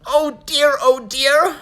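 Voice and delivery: high, loud nervous elf; fretting little elf official; fussy elf voice. Acted.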